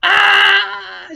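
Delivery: piercing loud high scream; high shriek. Retold or imitated. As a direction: scream like a startled woman. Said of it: "she was like".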